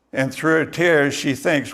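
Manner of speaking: ordinary talk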